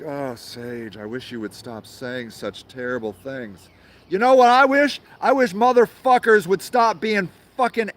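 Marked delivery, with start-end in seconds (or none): 0.0-3.7 soft, prissy mock whine; mock scolding liberal. Liberal scolding Sage for swearing, soft mock whine. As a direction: hush it like a fussy pearl-clutcher. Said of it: "They're like".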